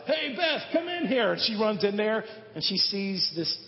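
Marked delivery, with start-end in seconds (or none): 0.1-1.3 loud high call for wife; yelling. Retold or imitated. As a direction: shout for her to come in. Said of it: "Sorry for yelling so loud".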